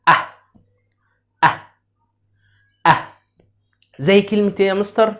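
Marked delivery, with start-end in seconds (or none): 0.1-3.1 "أه" as the short A sound of cat, cut off; letter sound demo. Shown as a demonstration. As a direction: sound out "أه" alone, a short open vowel. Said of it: "ونطق حرف ال A". (نطق "أه" كصوت حرف A القصير)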